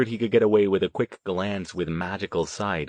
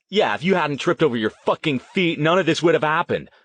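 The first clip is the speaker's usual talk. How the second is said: high, raised, heated interruption; angry blaming; 7 semitones higher, 4 dB louder than usual. A narrator's reading. Riley blaming Huff for tripping, angry blaming. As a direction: cut in and blame him crossly.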